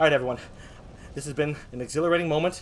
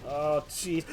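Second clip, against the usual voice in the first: ordinary talk, nothing marked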